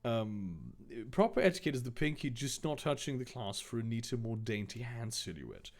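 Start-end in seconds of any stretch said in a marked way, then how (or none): 1.1-5.7 softer, fast, prim etiquette tutor; mock posh lecturer. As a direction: lecture daintily like a snob.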